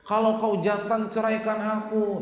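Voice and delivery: loud, high defiant challenge; angry wife. Acted. Wife shouting a dare at her husband, loud and high. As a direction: throw it at him as a dare.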